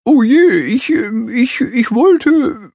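high, slow nervous stutter. A narrator's reading. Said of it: "stottert der Strauß".

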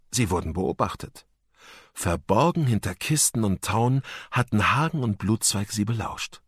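usual speaking voice

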